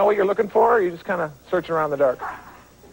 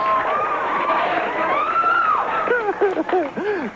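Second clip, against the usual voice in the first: big whooping laugh. Real, not acted. Host laughs at stud entrance.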